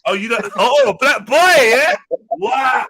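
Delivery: high, loud, hammed-up shock; mock outrage. Host hamming up outrage at his co-host's word. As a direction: play up the offence for laughs.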